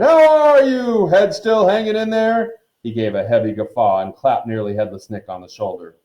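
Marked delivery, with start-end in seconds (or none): 0.0-2.5 hearty, high, loud bellow; blustering huntsman mocking a rival; roar. A narrator's reading. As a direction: stretch the greeting out boisterously. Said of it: "he roared".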